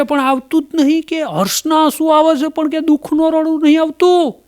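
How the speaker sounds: high, put-on helpless voice; widower. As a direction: whine it like a hapless widower. Widower explains he can't cry.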